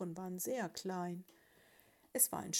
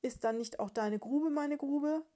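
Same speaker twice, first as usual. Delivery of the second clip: higher, louder mouse voice; entschlossene Haselmausbraut; 6 semitones higher, 5 dB louder than usual. A narrator's reading. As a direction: ask it with rising resolve, pitched up. Bride resolves to join him. (hoch und eindringlich gefragt)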